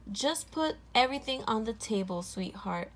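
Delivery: usual speaking voice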